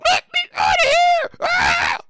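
high, loud scream to be let out; a trapped woman in a casket screaming in terror; high screaming panic from inside a coffin; panicked scream. Acted.

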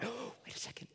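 unvoiced whisper of sudden realization; excited whisper. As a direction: breathe it out, the idea just hit.

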